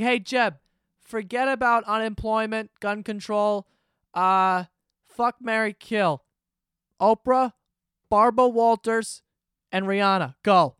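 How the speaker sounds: loud, high quizmaster parody; mock interviewer. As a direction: fire off options like a quiz host. Mimics interviewer posing absurd question.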